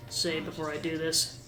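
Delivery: usual speaking voice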